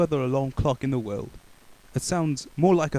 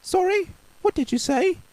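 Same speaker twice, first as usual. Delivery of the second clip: much higher innocent question; pretending not to hear; 4 dB louder than usual. Baker pretending not to hear Mister Noisy. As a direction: feign deafness sweetly. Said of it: "pretending not to hear".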